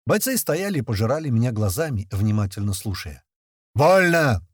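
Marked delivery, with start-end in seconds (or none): 3.7-4.5 loud, high drill-command yell. A narrator's reading. Narrator ends his spontaneous speech to fighters, yelled command. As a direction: yell it like a madman playing officer. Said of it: "вновь заорал я, как умалишённый".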